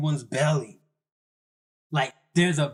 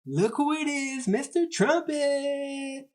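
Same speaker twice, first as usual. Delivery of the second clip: high-pitched imitation of excited drunk girls; mock drunken yell; 11 semitones higher than usual. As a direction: squeal it high, drawing out the name. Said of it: "and loudly".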